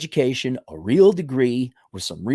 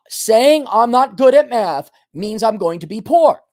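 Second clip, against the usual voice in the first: repeat after me; 6 semitones higher, 5 dB louder than usual. Shown as a demonstration. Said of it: "Repeat after, look in the mirror".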